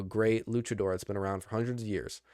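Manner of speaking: ordinary delivery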